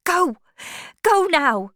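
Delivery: high, loud, pressing plea; urgent command. Acted. Woman sending suitor away as farmer nears, urgent. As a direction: send him away urgently. Tess urges Alec to leave.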